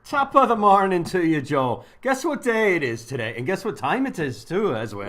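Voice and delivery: mock Irish accent. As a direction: greet him like a stage Irishman. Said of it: "I'm not gonna do that, uh, accent the whole day".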